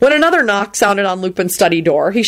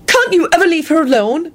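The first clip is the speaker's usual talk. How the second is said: snapping; 11 semitones higher than usual. A narrator's reading. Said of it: "she snapped".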